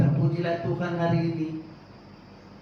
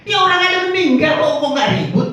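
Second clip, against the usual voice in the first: mock disbelief; 12 semitones higher, 12 dB louder than usual. Preacher mocks the question's oddness.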